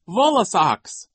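put-on high, loud, drawn-out toddler shriek. A narrator's reading. Sunny joins the fake joking, high shriek. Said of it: "Sunny shrieked".

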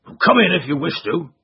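a hint of sullen surrender; grudging trainer. Trainer voiced.

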